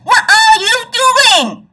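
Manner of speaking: demanding shout; angry shout. Shown as a demonstration. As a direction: scold them at full volume. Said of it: "Same words, same sentence. The impact is different".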